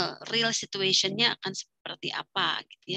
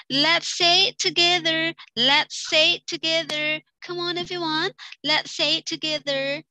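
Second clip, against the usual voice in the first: slow, raised, lively classroom call; teacher prompting class; 7 semitones higher, 4 dB louder, slower than usual. Shown as a demonstration. Teacher urging students to repeat together, slow and lively. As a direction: coax the whole class to chant along. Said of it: "Ekspresinya begini teman-teman ya".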